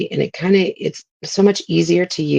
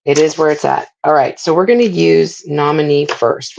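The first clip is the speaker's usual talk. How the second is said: normal talking voice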